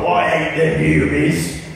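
rough-house Cockney orc; lightly voiced Cockney orc line. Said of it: "like, a weird Cockney".

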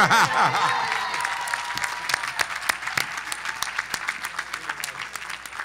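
long laugh trailing away; hearty laugh. Real, not acted. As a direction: burst out laughing, then let it fade. Laughs after alumni announcement.

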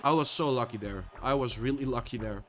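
low, soft aside after the win; subdued, relieved admission; quiet relief. Real, not acted. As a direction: drop right down and murmur it. Coming down after lucky win.

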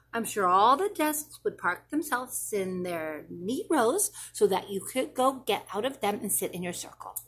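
ordinary delivery, nothing marked

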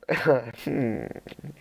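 low chuckle; laughing. Real, not acted. Streamer amused by his swimming story, laughing. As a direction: let out a quiet laugh.

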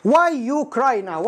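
slow, deliberate reading of the error; slow wrong example. Shown as a demonstration. Teacher slowly reads a learner's wrong question. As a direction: drag out the mistake. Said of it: "واحد يقول للثاني".

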